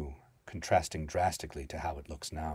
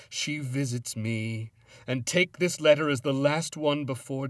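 tormented, building to a high, loud cry; anguished outburst; 5 semitones higher, 7 dB louder than usual. A narrator's reading. Haunted man writing his last letter, anguish building to a scream. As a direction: start raised and let it break into screaming despair.

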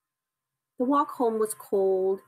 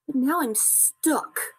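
slow, higher little cub voice; Baby Bear; 5 semitones higher, slower than usual. A narrator's reading. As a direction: draw it out as a stuck little cub. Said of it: "said Baby Bear".

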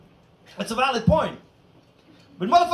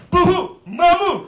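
slow, louder playful "Boo-hoo, mamu!"; a childish mock boo said slowly; mock scare; 4 semitones higher, 6 dB louder, slower than usual. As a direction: jump out and spook them, laughing. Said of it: "jumps out of the corner and is like".

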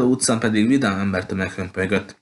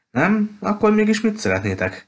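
much higher-pitched, astonished question; taken aback; 11 semitones higher than usual. A narrator's reading. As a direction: ask in high-pitched bewilderment, as the puzzled shop owner. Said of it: "kérdezte a tulajdonos hölgy megrökönyödve". (megrökönyödött, magas hangú kérdés)

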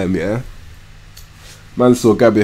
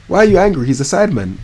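ordinary delivery

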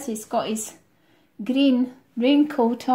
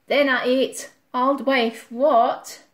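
plain reading voice

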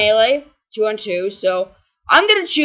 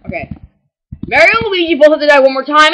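usual speaking voice

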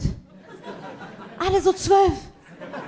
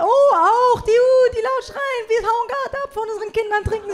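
exaggerated high-pitched party excitement; mock excited squeal; 8 semitones higher than usual. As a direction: play a tipsy mom on a night out. Imitating loud cheering moms.

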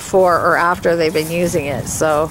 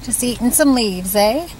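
pet talk; 6 semitones higher than usual. Real, not acted. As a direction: coo at the critter gently. Talking to a wild animal.

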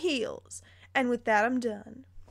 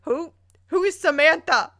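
outraged disbelief; 6 semitones higher, 8 dB louder than usual. Real, not acted. Outraged reaction to story twist.

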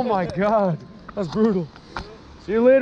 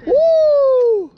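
high, loud victory whoop; excited whoop; 8 dB louder than usual. Real, not acted. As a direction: cheer the catch at full voice. Fish on, cheering loudly.

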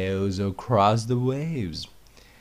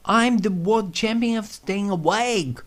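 much higher, louder boast; boastful squeaky voice; 13 semitones higher, 7 dB louder than usual. Acted. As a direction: brag like a tiny champion. Cherry Pig boasts.

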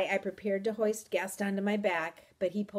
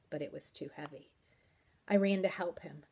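usual speaking voice

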